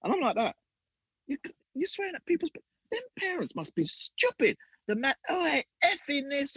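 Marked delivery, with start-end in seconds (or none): 1.3-4.6 high, fast mocking rant; mock foul-mouthed manager. Mocking the manager to the host, high and fast. 5.3-6.5 high put-on voice of the manager; mock swearing manager. Retelling the manager's swearing, high mock voice.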